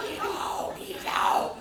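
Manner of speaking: voiceless demonic rasp; harsh, unvoiced possessed command; Exorcist voice. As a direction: force it out like a possessed thing. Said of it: "like that voice".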